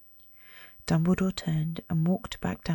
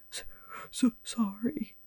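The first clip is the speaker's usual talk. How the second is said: sobbing; 4 semitones higher, 4 dB quieter than usual. Acted. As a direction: stammer it out through tears. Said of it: "sobbed Hagrid".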